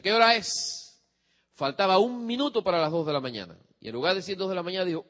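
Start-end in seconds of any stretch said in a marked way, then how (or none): 0.0-1.0 loud, pointed question; stern father. Retold or imitated. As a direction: make him confess the time.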